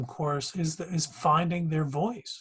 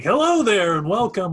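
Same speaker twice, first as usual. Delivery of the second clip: booming, higher mock announcer greeting; broadcast voice; 4 semitones higher, 9 dB louder than usual. Journalism teacher mocking students who turn on a broadcast voice, hammed-up announcer. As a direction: put on a big, loud broadcaster voice. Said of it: "immediately turn on your broadcast voice".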